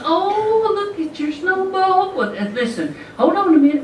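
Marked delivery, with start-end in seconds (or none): 0.0-2.2 very high, slow cooing mother voice; sweet Southern mom. Retold or imitated. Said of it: "my sweet little precious mom comes out and she says". 3.2-3.8 high-pitched imitation of mom; sweet Southern mom. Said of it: "my sweet little precious mom".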